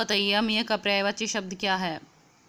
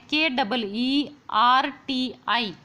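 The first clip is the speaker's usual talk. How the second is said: letters of Kirti spelled out slowly; spelling out slowly; much slower than usual. Shown as a demonstration. Teacher spells Kirti letter by letter for students. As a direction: spell each letter deliberately. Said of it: "वो कैसे लिखते हैं?".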